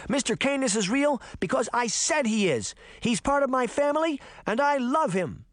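put-on high-pitched, impassioned child; defiant little girl. A narrator's reading. Daphne defending Mister Canis as family, impassioned. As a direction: insist with all your heart, pitched high.

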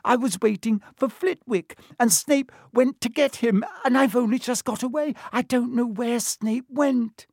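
anxious schoolgirl voice. A narrator's reading. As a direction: voice a fretting schoolgirl. Narrator voices Hermione.

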